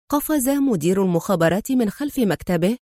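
plain reading voice

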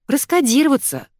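high, abrupt bark; sharply barked order. A narrator's reading. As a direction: snap out the only solution. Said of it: "рявкнула подруга".

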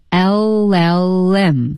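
"L L M" drawn out as el-lel-lem; slow linked demo. Shown as a demonstration. Co-host summing up the lesson, slow linked "L L M" as el-lel-lem. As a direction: stretch "L L M" in one unbroken flow. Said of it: "同时连读起来".

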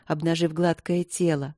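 ordinary delivery

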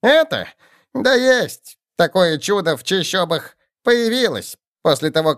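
high excited goblin chatter. A narrator's reading. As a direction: babble like an eager goblin. Goblin shaman explains excitedly.

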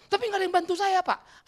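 high, loud complaining objection; mock protest. Pastor protesting that nobody helps him, mock complaint. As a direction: protest upward like a wronged subordinate.

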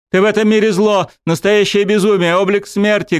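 high, loud tearful scream. A narrator's reading. Dryad screaming accusations at the god of death. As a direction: scream it at him with everything. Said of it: "кричала она".

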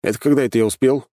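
quick, high-pitched retort; taken-aback question; surprised. A narrator's reading. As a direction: ask it fast in surprised protest. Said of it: "удивился я".

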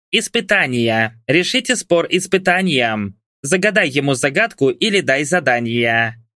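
ordinary delivery, nothing marked

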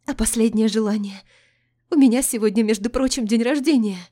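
high, loud panicked plea. A narrator's reading. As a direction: beg in sudden panic.

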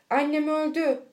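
high, loud cry of alarm. A narrator's reading. Boy leaps up fearing death. (telaşla haykırarak)

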